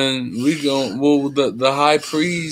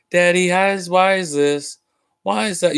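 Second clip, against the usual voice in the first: put-on higher pitched little kid, pestering daddy with questions; curious child; 8 semitones higher than usual. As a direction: lightly play a kid asking why. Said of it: "You be like".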